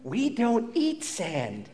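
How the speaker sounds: soft, slow gentle correction; softly, slowly steer the toddler away. Retold or imitated. Wife gently telling the little kids not to eat sand, soft and slow. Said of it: "my wife would very gently say".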